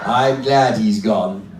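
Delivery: low, slow husband puppet; put-on deep male character; man's dummy voice. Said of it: "What about a voice like this?".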